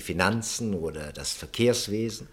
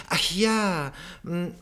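usual speaking voice

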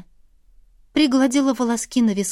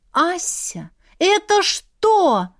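high, drawn-out scandalized question; morality inspector's tone; 8 semitones higher, much slower than usual. A narrator's reading. Sister finds a spoiled blouse, stern inspector's tone. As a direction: stretch it out like a prim inspector. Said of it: "спросила она тоном инспектора нравственности".

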